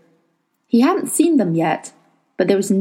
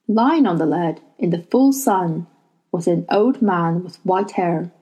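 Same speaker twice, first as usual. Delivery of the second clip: ordinary delivery, nothing marked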